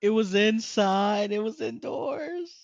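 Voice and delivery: slow, high disbelieving question; shocked. Real, not acted. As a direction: stretch it out in disbelief.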